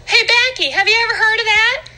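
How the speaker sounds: high bubbly lady calling her friend; squeaky gossip voice, calling across the room; high chatty lady voice. Acted.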